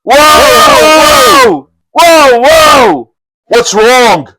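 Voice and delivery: high frantic cries of alarm; crying out. Acted. Brigands rushing in to warn their chief, frantic shouting. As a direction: bandits shouting whoa in panic.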